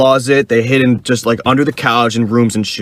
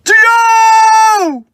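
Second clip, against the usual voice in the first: very high, loud call back from far off; high shout from a distance; distant scream; 4 dB louder than usual. Retold or imitated. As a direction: answer your friend's shout across the forest. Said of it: "I hear my buddy scream".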